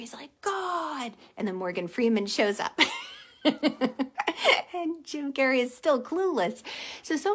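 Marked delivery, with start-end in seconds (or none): 0.4-1.1 mock angry cry. Driver out of the wrecked car, mock angry. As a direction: cry out to heaven in imitated anger. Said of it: "He's so angry".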